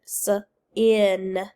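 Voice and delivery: "S-in" tapped out as s, then in; sounded out. Shown as a demonstration. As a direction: break "S-in" into onset and rest. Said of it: "Tap it".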